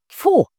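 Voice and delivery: loud high spitting Fu; disgusted spit. Acted. Sister spits in disgust.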